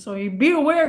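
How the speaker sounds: ordinary talk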